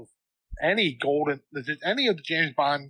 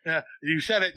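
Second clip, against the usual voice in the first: ordinary talk, nothing marked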